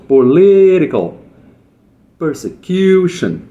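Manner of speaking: "political persecution" said slowly, stressed syllables brought out. Shown as a demonstration. Teacher showing students the stress in "political persecution", slow and deliberate. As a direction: drag each word slowly to show the stress. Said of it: "Olha lá o apóstrofe de novo".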